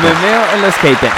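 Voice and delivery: loud slogan echo. Acted. Echoing slogan.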